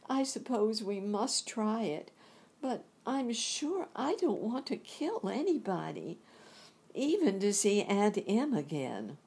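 put-on a little girl unwilling but brave; lifted girlish voice; Dorothy's voice. Dorothy's reply, quoted in the story.

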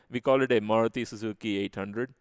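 ordinary talk, nothing marked

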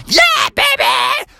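very high, slow drawn-out whoop; wild cheer. Acted. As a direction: yell it as you fly. Frog jumping ring of fire.

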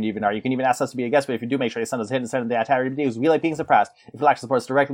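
fast auctioneer patter. Pretend. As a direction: rattle it off like an auctioneer. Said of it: "every time I auctioneer".

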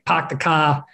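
light Boston accent imitation; playful Boston accent. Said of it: "I had this Massachusetts accent".

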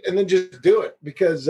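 usual speaking voice

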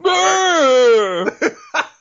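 high, loud drawn-out mock scream; badly faked death scream, held long; Robert's scream. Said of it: "my impression of Robert trying to do that voice".

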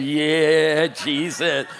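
hammed-up cheer, laughing at the end; mock rejoicing. Preacher re-enacting his upgrade news, mock jubilation. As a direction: let out a playful hallelujah cheer. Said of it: "I'm going".

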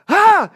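sudden very loud, high "Ah!"; reenacted boo-style shout; jump-scare yell. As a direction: burst out yelling to startle someone. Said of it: "I'm gonna scare Mom".

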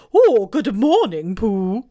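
put-on loud, slow, hooting old owl. Owl greeting Pooh, loud slow hooting owl voice. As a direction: boom it out like a pompous owl. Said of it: "said Owl".

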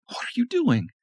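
wife alarmed by the knife; alarmed Lisa. A narrator's reading. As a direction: gasp out in alarm.

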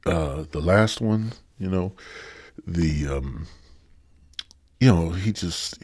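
ordinary delivery

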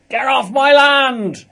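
loud, slow West Country yell; West Country accent. Retold or imitated. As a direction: bellow it like a West Country farmer. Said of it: "in a kind of West Country accent".

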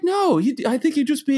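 high imitation of wife; high-pitched wife. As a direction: mimic her lightly.

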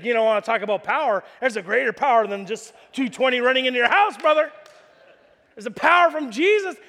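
loud, fast, fired-up preaching to a customer; the electrician preaches hard at the homeowner; preachy electrician. Retold or imitated. Electrician lecturing the homeowner, loud and fast. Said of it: "He's like".

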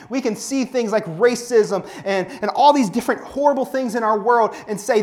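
loud, high, fervent exhortation; rally the congregation, building to a cry; preaching at full force. Acted. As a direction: passionate preacher pounding home each line.

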